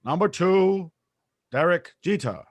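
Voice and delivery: hint of a ballpark PA announcer; stadium announcer. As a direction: call it out like a stadium PA man. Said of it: "He hears the announcer going".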